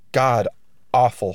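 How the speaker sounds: usual speaking voice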